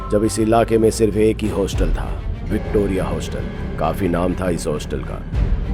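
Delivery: plain reading voice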